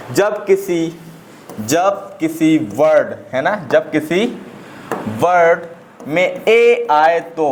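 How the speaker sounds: very slow, word by word, with pauses; slow dictation. Real, not acted. Teacher dictating the second rule to students, slow dictation. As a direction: dictate it in short separated chunks.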